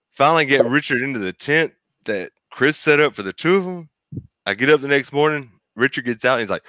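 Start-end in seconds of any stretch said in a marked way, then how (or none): none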